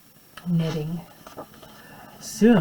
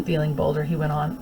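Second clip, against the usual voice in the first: ordinary delivery, nothing marked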